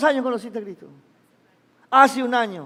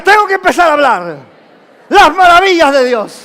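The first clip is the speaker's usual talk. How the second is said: very loud, driving declaration; rally the crowd to echo it; rallying shout; 3 semitones higher, 9 dB louder than usual. Shown as a demonstration. Preacher has the congregation repeat it, rallying shout.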